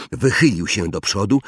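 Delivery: plain reading voice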